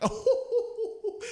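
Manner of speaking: sudden high burst of laughter; gleeful laugh. Real, not acted. Delighted laugh at money compounding on its own.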